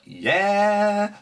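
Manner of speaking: high, drawn-out triumphant "Yeah"; gloating yeah. Retold or imitated. Gloating at the opponent over his card, drawn-out high yeah. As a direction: stretch it out smugly.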